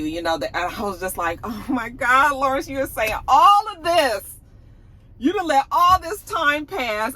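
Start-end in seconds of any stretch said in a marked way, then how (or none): 1.4-7.1 high, louder mock disbelief; exasperated reaction. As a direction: scold him in exasperation, pitch jumping up. Acted exasperated reaction to Lawrence.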